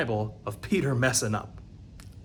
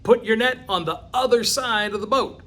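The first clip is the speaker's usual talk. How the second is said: raised, higher call across the water; 6 semitones higher, 4 dB louder than usual. Acted. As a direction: call it out loud to someone far off on a boat. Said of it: "he calls out to Peter".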